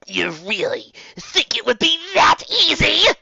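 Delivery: high, slow, taunting sorceress; smug evil enchantress; mocking villain. A narrator's reading. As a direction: savor the taunt from above. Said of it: "she heard Torka ask".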